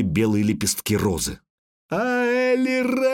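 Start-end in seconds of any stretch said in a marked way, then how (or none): 1.9-3.1 very high, long-held wail of the name; heartrending cry. A narrator's reading. Elf struck down, sinking to her knees, heartrending cry. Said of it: "душераздирающе крикнула эльфка".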